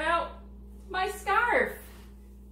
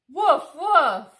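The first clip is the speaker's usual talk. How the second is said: loud playful puppy woofs; puppy barks; 5 semitones higher, 16 dB louder than usual. Acted. Being Biscuit the puppy for the kids, loud playful woofs. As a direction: bark like a happy little dog.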